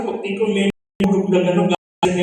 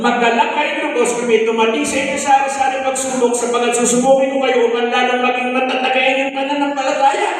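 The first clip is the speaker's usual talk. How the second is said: raised, ringing preacher voice reading scripture; preaching scripture; 4 semitones higher, 6 dB louder than usual. Acted. Ex-convict testifying to the church, reading scripture in preaching voice. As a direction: proclaim the verse to the congregation.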